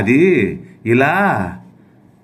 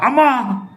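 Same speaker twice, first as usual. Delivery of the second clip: high cry of pain; 11 semitones higher than usual. Acted. Cry after being hit.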